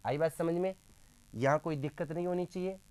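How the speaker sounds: ordinary talk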